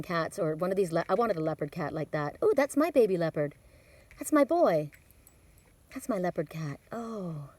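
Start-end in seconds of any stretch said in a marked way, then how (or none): none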